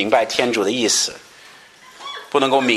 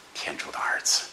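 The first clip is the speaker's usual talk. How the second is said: breathy whisper of faith; a hushed, reverent whisper; 8 dB quieter than usual. Acted. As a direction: let the confession fall to a whisper. Quote fading to whisper.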